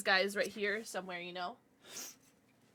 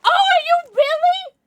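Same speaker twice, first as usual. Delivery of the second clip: high, loud, drawn-out squeal; delighted surprise; 13 dB louder than usual. Real, not acted. Streamer reacting to news from a viewer, delighted high squeal. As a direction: squeal it slowly in amazement.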